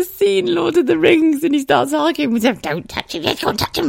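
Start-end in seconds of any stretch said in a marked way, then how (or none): 2.6-3.2 hushed half of Gollum's argument, softer; Gollum arguing. Retold or imitated. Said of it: "It's like Schmiegel". 3.2-3.9 much higher Gollum voice answering himself; Gollum arguing. Retold or imitated. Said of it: "It's like Schmiegel".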